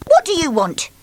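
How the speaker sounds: high, curt; bossy demand. Acted. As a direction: bark at the door. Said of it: "demanded Miss Bossy".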